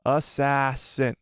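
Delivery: stretched out while typing; slow. Real, not acted. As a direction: drag the word out slowly. Spelling it out slowly.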